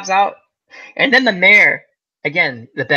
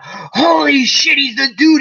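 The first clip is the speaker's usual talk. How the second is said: slow, higher shouted realization; surprised shout; 10 semitones higher, 3 dB louder, slower than usual. Retold or imitated. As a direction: shout it as the penny drops. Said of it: "I went".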